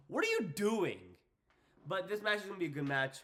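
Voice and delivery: usual speaking voice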